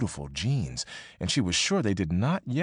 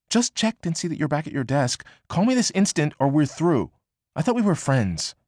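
Annie's ultimatum; 7 semitones higher, 3 dB louder than usual. Acted.

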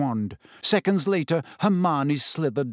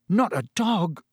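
pained moan, pitched up; 5 semitones higher than usual. A narrator's reading. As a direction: groan it out through pain. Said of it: "Ron moaned".

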